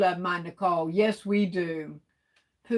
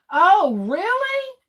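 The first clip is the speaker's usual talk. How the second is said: surprised; 10 semitones higher, 4 dB louder, slower than usual. Real, not acted. Surprised by shopping tip.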